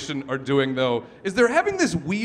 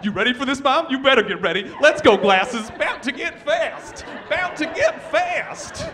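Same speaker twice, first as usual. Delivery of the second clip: put-on hyped-up kid; 7 semitones higher than usual. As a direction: hype up the passengers like a kid. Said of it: "he starts trying to hype up people".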